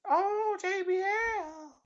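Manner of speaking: very high falsetto commentator; Cole impression. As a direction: squeal it in a mock high voice. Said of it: "And Cole was like".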